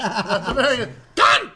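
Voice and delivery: very high, loud barked word; hammed-up shouted word; mock shout. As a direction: spit it out like a parody. Joking Nazi-ish K word.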